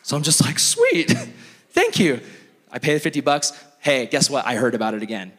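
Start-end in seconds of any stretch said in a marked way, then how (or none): 0.6-2.2 delighted. Retold or imitated. Delighted reply to advice.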